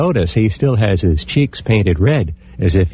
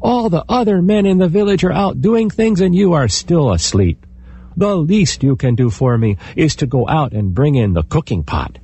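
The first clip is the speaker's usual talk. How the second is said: scolding wife; 7 semitones higher than usual. Acted. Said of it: "his wife poked him and said".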